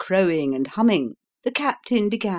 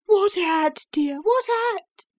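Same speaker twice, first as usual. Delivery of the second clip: high, fussing, comforting question; soothing mother; 4 dB louder than usual. Acted. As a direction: coo over the frightened child. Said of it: "said the mother, soothing".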